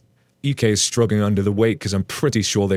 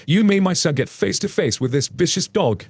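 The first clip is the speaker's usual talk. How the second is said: yelling angry dad; 8 semitones higher than usual. A narrator's reading. Angry dad yelling at the dog owner over his son. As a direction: hurl it at him like an accusation. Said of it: "and he yelled it".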